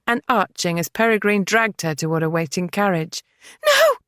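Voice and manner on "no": much higher, louder shriek of no; scream. A narrator's reading. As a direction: scream it in panic. Dragged to carriage screaming.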